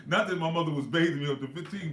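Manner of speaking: words bubbling over with real laughter; laughing. Real, not acted. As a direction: crack up while getting the words out. Laughing at own joke.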